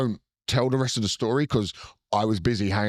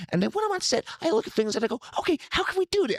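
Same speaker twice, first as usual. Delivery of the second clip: lightly done Tarantino voice, pitched up; a light take on Tarantino's excited voice; a casual Tarantino impression, pitched up; faster than usual. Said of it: "without doing the voice".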